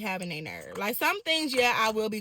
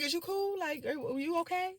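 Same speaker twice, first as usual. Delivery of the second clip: higher and quieter, feigned concern; softer, mock sweet; 7 semitones higher, 6 dB quieter than usual. Repeating it to the driver, softer and sugary. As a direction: ask again softly, almost syrupy. Repeats the question more softly.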